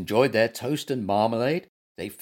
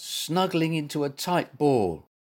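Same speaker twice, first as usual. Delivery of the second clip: ordinary delivery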